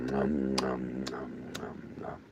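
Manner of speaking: soft mock munching; chewing noises. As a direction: imitate a bear chewing. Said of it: "It's like".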